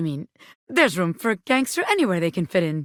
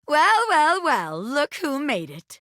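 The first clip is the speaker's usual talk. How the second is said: slow, drawn-out smug greeting; smug welcome; 5 semitones higher, slower than usual. Acted. Bobby Pin greeting the recruit, smug and slow. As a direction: savour the welcome like a boss.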